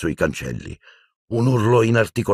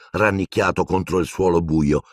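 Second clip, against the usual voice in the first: ordinary delivery, nothing marked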